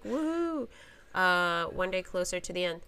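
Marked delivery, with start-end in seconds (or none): 0.0-0.7 high, sarcastic woohoo; mock cheer. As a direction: cheer it with fake joy. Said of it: "every morning I wake up and I'm like".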